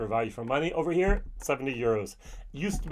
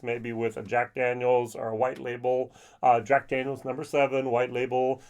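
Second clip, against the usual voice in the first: ordinary delivery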